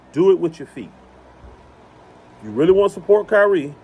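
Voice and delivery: normal talking voice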